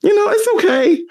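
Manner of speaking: high, reluctant shrug of a voice; mock resignation. Gamers accepting mediocre remakes, high grudging voice. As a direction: squeak it out half-heartedly. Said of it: "we just were like".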